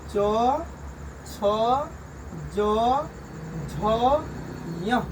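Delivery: slow, spaced letter-by-letter recitation; teacher reading the alphabet aloud for pupils; letter drill. Shown as a demonstration. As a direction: call out each letter with a pause. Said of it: "इसको कैसे पढ़े हैं?".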